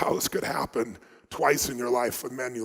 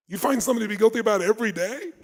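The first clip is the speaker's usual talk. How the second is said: higher, fast disbelieving question; incredulous; 7 semitones higher, 3 dB louder, faster than usual. Retold or imitated. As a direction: ask it in amazed disbelief. Incredulous question to guilty woman.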